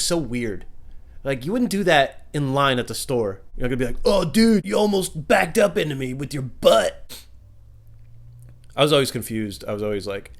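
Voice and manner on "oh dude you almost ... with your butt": mock rage. Mock road rage in store.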